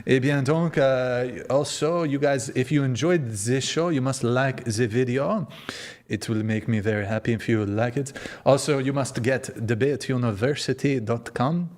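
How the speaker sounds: put-on French accent with English mixed in. Host plugs the show to viewers, hammy French accent. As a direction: play a Frenchman pitching the show. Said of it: "what the fucking accent I'm doing".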